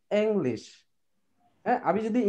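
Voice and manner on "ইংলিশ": ইংলিশ as "eng-lesh", opening on an e sound; corrected pronunciation. Shown as a demonstration. Teacher models English for guardians, corrected "eng-lesh". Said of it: "কিভাবে আমি ইংলিশটা বলবো?".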